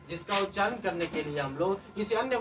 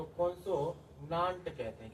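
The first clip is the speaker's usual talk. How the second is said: slow, softer, consonant broken into parts; slow dictation; 2 semitones lower, 7 dB quieter, slower than usual. Shown as a demonstration. Teacher giving the English term, slow dictation. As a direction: spell out consonant slowly by syllable.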